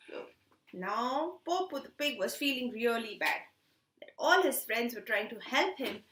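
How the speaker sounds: usual speaking voice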